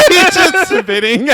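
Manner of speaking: high, loud and slow, through laughter; laughing. Real, not acted. Telling a friend the spinning car joke, laughing. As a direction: say it while still cracking up.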